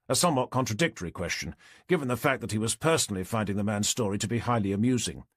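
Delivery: usual speaking voice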